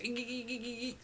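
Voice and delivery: shrill creature squeaks; tiny high-pitched squeals; high squeaking. Acted. GM voices Eris squeaking.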